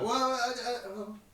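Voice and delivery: high, halting mock stammer; flustered guard. Game master as guards confused by their boss, flustered. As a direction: stumble upward as a confused underling.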